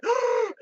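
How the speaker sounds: sharp, loud, high-pitched gasp; shocked gasp. Retold or imitated. Girl recognizes him and freaks out.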